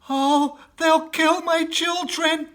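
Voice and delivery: high, loud and slow in terror; a mother crying out for her children; fearful wail; anguished cry. Acted. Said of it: "cried Missus Fox".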